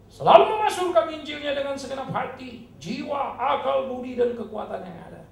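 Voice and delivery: loud, high, ringing call; fervent exhortation. Acted. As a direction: proclaim it with full fervor. Climactic sermon exhortation.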